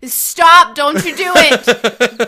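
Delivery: loud, slow Stop! Don't you do it; loud playful warning; yelled warning. Real, not acted. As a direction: shout him off before he starts. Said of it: "when I yelled at you just then".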